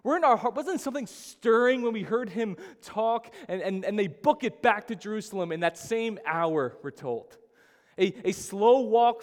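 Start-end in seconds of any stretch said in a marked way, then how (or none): 0.0-3.3 excited disciples. Retold or imitated. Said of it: "they're saying to one another".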